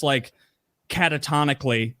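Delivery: ordinary talk, nothing marked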